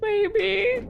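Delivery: very high, rising pitch; squeaky amused maybe. Real, not acted. Streamer doubting their own guess, high squeak. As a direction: squeak it out just after giggling. Repeats guess after laughing.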